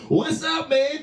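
normal talking voice

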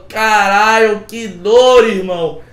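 loud, pained inner cry of agony; a hammed-up yell of pain at the tattoo. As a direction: shout it like the needle hurts. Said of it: "por dentro eu tava".

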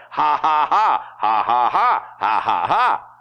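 loud, rhythmic fake laughter in bursts; forced laugh. Shown as a demonstration. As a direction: punch out each "ha" deliberately. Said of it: "If you have to go".

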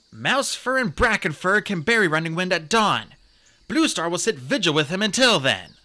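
firm, urgent command; taking charge. A narrator's reading. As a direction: give orders to the whole camp. Deputy gives burial orders.

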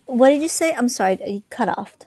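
ordinary talk, nothing marked

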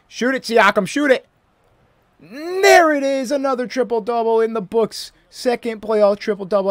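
rising hyped shout; excited urging. Real, not acted. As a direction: build up yelling for the shot. Urging teammate, triple-double.

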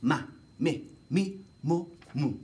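"Ma, me, mi, mo, mu" each syllable crisp; over enunciating. Shown as a demonstration. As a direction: say each syllable clearly and fully.

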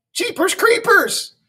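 startled exclamation. A narrator's reading. Teacher baffled by the nurse's silly words, high-pitched astonishment.

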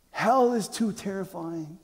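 normal talking voice

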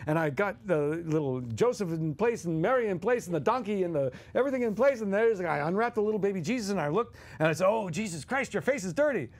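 high, giddy storyteller voice of Dom; Dom DeLuise impression. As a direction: ham up Dom's live Christmas story. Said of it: "Dom DeLuise goes off".

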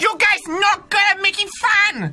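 high, indignant mock-Chinese scolding; hammed-up Chinese-accented complaint. Imagined friend scolds the players, mock Chinese accent. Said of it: "That is not an accurate accent".

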